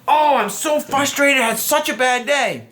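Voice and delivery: high, whiny mock girl voice; imitating a frustrated girl. Girlfriend venting about her bad day, in a high mock whine. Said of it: "So a girl says to you".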